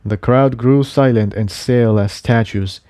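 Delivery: plain reading voice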